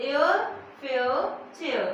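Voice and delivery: एयर, fair, chair stretched out slowly; slow sound drill. Shown as a demonstration. Teacher drills the air sound, slow and stretched. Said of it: "एयर निकल रहा है इसमें".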